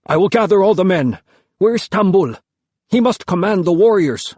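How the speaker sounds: a father rallying his men in alarm; urgent, panting. A narrator's reading. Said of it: "panting as if he'd been running".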